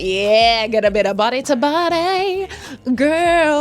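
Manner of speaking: high, loud mock relish; hammed-up delight. Man imagined enjoying a hug on his lap, hammed-up delight. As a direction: belt it out, relishing the moment.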